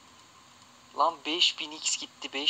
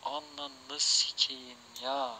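defeated mutter; 5 semitones lower, 9 dB quieter, slower than usual. Real, not acted. Defeated after missing the win.

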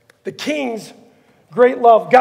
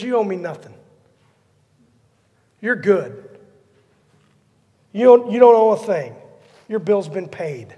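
a hint of a kind king letting him go; gentle forgiving king; 4 semitones lower than usual. King forgiving the kneeling servant.

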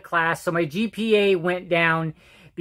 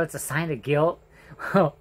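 ordinary talk, nothing marked

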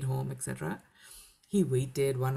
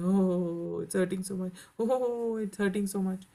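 repeated pained moan, pitch rising on the second Oh; mock whimper of a wife with a headache; 3 semitones higher than usual. Wife fakes a painful headache to her husband, mock moaning. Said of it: "And she's like".

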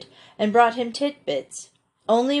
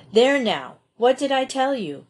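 higher-pitched, mildly aggrieved complaint; complaining; 6 semitones higher than usual. A narrator's reading. Boy whose witch theory is dismissed, complaining. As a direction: protest with a touch of grievance. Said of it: "complained Peter".

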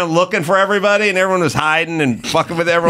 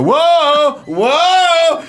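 high, louder teasing whoa while tossing; mock whoa; 12 semitones higher, 4 dB louder than usual. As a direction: tease him as the pot flies up. Ray tossing the pot up.

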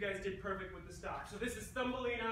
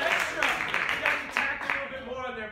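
louder, higher call to the crowd; 5 semitones higher, 11 dB louder than usual. Real, not acted. Host talks to the crowd after the scene, loud call-out. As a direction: call it out over the room.

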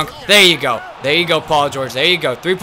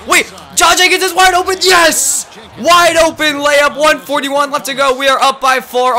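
much higher, loud commentary yell; excited announcer shout; 11 semitones higher, 6 dB louder than usual. Real, not acted. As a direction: scream the name like a buzzer call. Yelling live play-by-play.

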